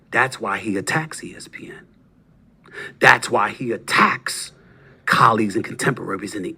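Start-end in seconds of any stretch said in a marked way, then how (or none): 0.1-1.9 hushed whisper, leaning in. Real, not acted. Host whispering a jab at his rival. 3.0-4.5 slow, deliberate, each word weighed; slow emphasis. Real, not acted. Host repeating his accusation slowly, deliberately.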